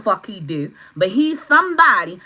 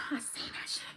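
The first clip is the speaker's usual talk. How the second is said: whisper; 18 dB quieter than usual. Real, not acted. Stunned after screaming.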